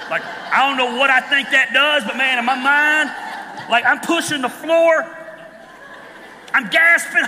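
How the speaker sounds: loud, high panicked yelling; panicked passenger. Retold or imitated. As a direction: yell like you're braking from the passenger seat. Reliving panic in the passenger seat.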